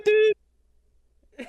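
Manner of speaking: high, loud "dude, dude"; mock yell. Friend retelling, yelling dude at his buddy. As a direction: call out sharply after him. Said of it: "I was like".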